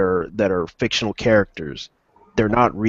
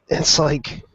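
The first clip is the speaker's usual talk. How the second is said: ordinary delivery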